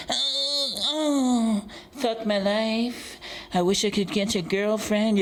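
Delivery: high, whining mock self-pity; whiny loser voice. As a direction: whine it like a sulky loser. Mocking haters in their rooms.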